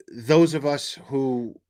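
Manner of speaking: normal talking voice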